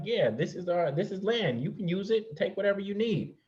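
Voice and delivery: high-pitched imitation of generous, trusting hosts; mock easygoing welcome. Ancestors telling newcomers to use the land, naive high-pitched welcome. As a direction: voice them high and freely obliging.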